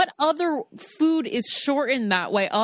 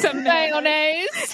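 very slow, high, drawn-out echo; mocking the date; 7 semitones higher, much slower than usual. Friend echoing the icky date's order, mocking. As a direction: stretch mayonnaise out in a put-on voice.

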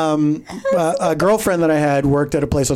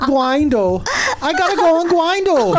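high, hammed-up toddler voice; mock baby talk; 10 semitones higher, slower than usual. Joking that dating apps suit baby talk, high toddler voice. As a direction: say it like a babyish little kid. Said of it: "they're good with baby talk".

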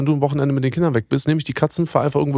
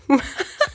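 short high giggle; high laugh; 14 semitones higher than usual. Real, not acted. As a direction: laugh off the teasing. Laughing at end of banter. (kurzes hohes Lachen)

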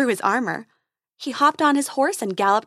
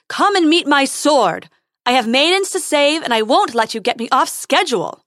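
put-on loud, higher, brash young hero; heroic challenge; 4 semitones higher, 8 dB louder than usual. A narrator's reading. Prince challenging the hidden dragon, loud and brash. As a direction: puff up and challenge the beast.

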